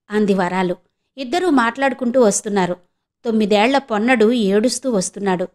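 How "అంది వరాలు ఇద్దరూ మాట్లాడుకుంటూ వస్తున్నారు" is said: ordinary delivery, nothing marked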